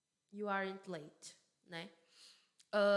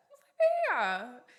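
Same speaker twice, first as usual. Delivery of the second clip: loud, playful mock astonishment; mock surprise; 4 semitones higher, 10 dB louder than usual. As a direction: act amazed, louder and higher. Joking reaction to her example question.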